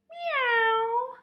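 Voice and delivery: very high, loud kitten meow. A narrator's reading. Puppy George meowing at the vet, high. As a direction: squeak it like a kitten. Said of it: "George went".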